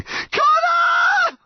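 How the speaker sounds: piercingly high scream of a name; panicked yell. A narrator's reading. Mum's hands slipping, yelling to her son. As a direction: scream it out, stretched long. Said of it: "she yelled again".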